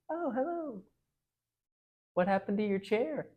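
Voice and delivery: high, falsely sweet witch voice; gritted-teeth sweetness. Acted. The wicked queen greeting the freed prince, sickly sweet. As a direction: smile through clenched teeth. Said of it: "through a gritted teeth smile".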